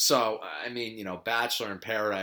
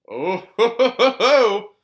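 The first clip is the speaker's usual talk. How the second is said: laughing; 11 semitones higher, 11 dB louder than usual. Real, not acted. Laughing at own gross story.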